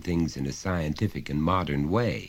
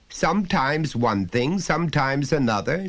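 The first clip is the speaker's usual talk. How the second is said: high, loud, lecturing father voice; a father talking down to his son, patiently insistent; stern father; 11 semitones higher, 6 dB louder than usual. Acted. Father pressing his small son, loud and high.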